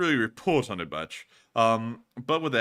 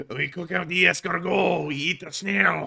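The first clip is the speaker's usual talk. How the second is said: hammed-up French accent with a laugh; mock French accent; 5 semitones higher, 5 dB louder than usual. Streamer hamming a French accent for viewers. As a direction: play the cheesy Frenchman, laughing. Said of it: "I went from being French".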